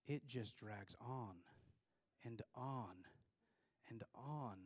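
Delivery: very slow, drawn-out and on; dragged out. Real, not acted. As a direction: drag each and on out. Describing a dull phone call.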